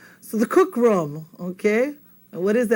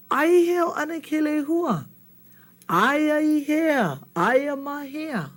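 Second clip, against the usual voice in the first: asking a companion; 7 semitones higher than usual. Acted. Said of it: "Pualei turns around and says".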